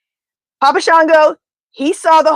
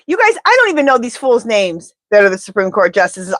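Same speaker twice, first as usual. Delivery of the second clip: usual speaking voice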